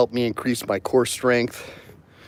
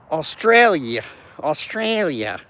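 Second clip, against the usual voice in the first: slow Aussie "Australia", pitched up; mock Australian; 4 semitones higher, slower than usual. Vlogger repeats a viewer's pronunciation, mock Aussie. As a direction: savour the Aussie vowels. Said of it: "I'll help you pronounce it".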